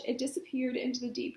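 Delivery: usual speaking voice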